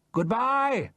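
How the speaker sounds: one final call to shore; a parting shout; last shout. Acted. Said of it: "I shouted one last time".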